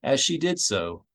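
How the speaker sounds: ordinary delivery, nothing marked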